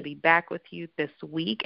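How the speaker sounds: ordinary delivery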